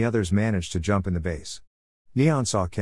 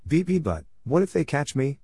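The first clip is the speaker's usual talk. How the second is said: stuttered, higher-pitched worry; nervous; 6 semitones higher than usual. A narrator's reading. Dean fears being caught by Dan, mildly nervous. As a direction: stumble lightly on the first word, nervously. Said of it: "said Dean nervously".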